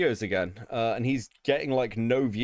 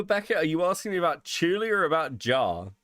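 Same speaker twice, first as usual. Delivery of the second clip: ordinary talk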